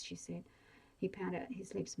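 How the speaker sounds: ordinary delivery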